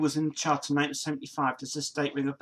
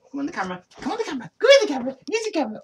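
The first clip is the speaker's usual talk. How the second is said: much higher, sing-song coaxing; coaxing the dog; 12 semitones higher, 7 dB louder, much faster than usual. Real, not acted. Owner coaxes his dog onto the camera, high and sing-song. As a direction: call the dog in a high doggy voice.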